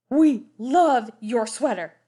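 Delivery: a lightly voiced gushing clown. A narrator's reading. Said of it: "they told him".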